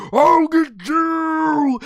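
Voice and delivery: loud, high, very drawn-out shout; frustrated battle yell. Acted. Outclassed fighter lunging at Vegeta, long yell. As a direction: bellow it as a mock threat.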